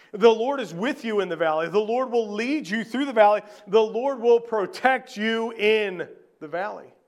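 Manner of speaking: full-force preaching. Acted. Pastor proclaiming to the congregation, loud and lifted. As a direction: preach it out with conviction.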